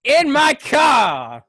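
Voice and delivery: drawn-out yelled line in a put-on voice; loud slow shout. Driver bellowing about snakes in his car, slow loud shout. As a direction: bellow it slowly, impersonating.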